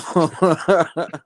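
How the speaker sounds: short sheepish laugh at himself; embarrassed laugh. Real, not acted. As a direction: laugh off his own bad Russian. Laughs at his bad Russian.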